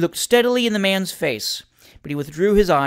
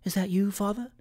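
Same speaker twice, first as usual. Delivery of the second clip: small, high, soft anxious question; hushed, anxious girl's voice; 4 semitones higher, 6 dB quieter than usual. A narrator's reading. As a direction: ask it timidly, softly and high. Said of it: "peeped anxiously out".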